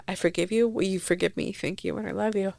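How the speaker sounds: soft tender plea. Retold or imitated. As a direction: say it softly, as if to your dad. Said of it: "that I wanna say to my".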